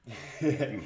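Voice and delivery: loud burst of laughter; breathless loud laugh. Real, not acted. Laughs loudly after whistling.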